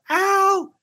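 put-on high little-kid sideline report; kid sideline reporter. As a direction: play a kid on the field throwing back to Al. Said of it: "let's get it over to [name] now".

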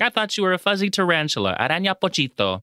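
put-on quick, high baby talk to a pet; fast cutesy pet voice; cutesy animal voice. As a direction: rush it out sweetly, up high. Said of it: "cutesy animal voices".

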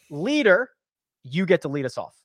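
ordinary delivery

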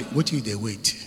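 ordinary talk

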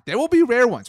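spring it up excitedly; excited pitch jump. Real, not acted. Excited bragging.

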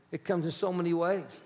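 ordinary delivery

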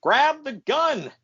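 imitated yelling; mock yell. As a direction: bark the order. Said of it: "King is yelling at Kevin Kelly".

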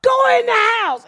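put-on high sharp shout at a child. Ordering the little girl off the roof, high shout.

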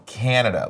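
"Canada" with a flat, stressed CAN like a can of Coke; "Canada" stressed on the first syllable; American stress on "Canada". Shown as a demonstration. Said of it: "like a can of Coke".

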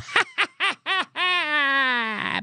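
big laugh. Real, not acted. Comic laughs at his childhood joke, wheezing laugh. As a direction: crack up at the joke.

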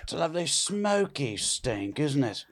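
genteel fancy English; understated upper-class English. Acted. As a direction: savour it in put-on posh English. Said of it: "Is this fancy English".

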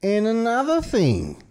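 hammed-up officer adding news; mock police announcement. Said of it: "they were like".

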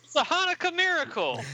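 high, hammed-up joyful proclamation; acted amazement. Colossal Boy hails the oil, excited exclamation. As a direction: proclaim the miracle with mock wonder.

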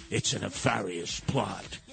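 mock villain voice. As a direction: ham up a sinister plot announcer. Mock sinister Chinese plot voice.

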